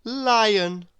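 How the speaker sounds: usual speaking voice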